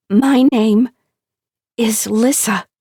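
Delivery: high, loud, triumphant. Acted. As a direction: announce the name with delight. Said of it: "She says it louder, again and again".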